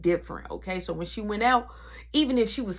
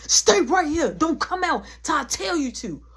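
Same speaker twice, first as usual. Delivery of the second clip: raised, heated command; retold yelling; 6 semitones higher, 4 dB louder than usual. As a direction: act out ordering someone to stay put. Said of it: "I'm yelling, I'm going off".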